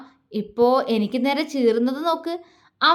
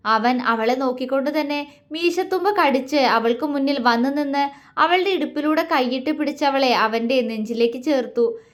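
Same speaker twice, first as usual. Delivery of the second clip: ordinary delivery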